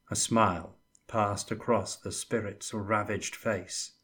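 usual speaking voice

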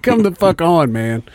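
high start dropping on "man"; retold "give me a break" protest; exasperated. Retold exasperation at excited coworker.

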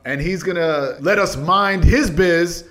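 ordinary talk, nothing marked

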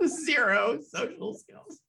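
slow words through laughter; amused, drawn-out verdict; laughing. Real, not acted. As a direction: crack up while saying it. Laughing at the character.

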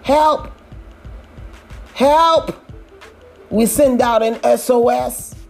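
usual speaking voice

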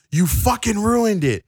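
imitating a boss screaming mid-broadcast; retold furious scream. Vince McMahon screaming into Renee's headset, angry yell. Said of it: "screaming in my ear".

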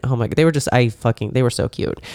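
usual speaking voice

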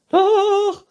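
very high, loud retort; princess calling out. A narrator's reading. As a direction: shriek back stubbornly as princess. Princess figure contradicting. (trotziger, hoher Prinzessinnenschrei)

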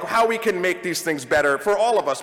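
ordinary talk

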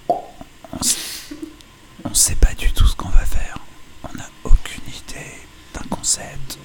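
breathy ASMR whisper into the mic. Shown as a demonstration. Whispering a podcast pitch to friends with their ears covered. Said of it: "Je vais murmurer au micro".

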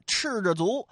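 ordinary delivery, nothing marked

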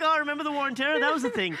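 laughing, mock nostalgia for the war on terror; mock nostalgic. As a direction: play fondly reminiscing about an old fad, amused. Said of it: "because I'm like".

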